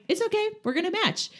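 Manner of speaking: put-on very high, sing-song kid voice; cheerful reassurance. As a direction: reassure your friend with a silly grin. Retelling cheerful reassurance to friend.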